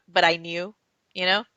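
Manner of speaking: ordinary talk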